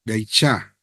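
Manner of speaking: Beicha, low and deliberate, bai then cha; Hebrew reading. Shown as a demonstration. Teacher confirming a student's Hebrew, low and steady.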